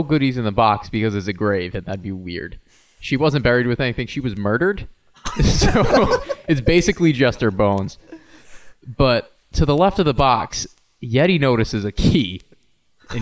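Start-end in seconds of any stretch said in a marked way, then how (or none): none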